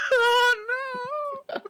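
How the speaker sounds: very high laughing shriek. Real, not acted. Laughing scream.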